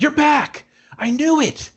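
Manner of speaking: high, gushing mock-excited guy; excited Toby. Toby gushing to his returned girlfriend, high mock excitement. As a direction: squeal the reunion like a lovestruck dork. Toby greeting her, acted quote.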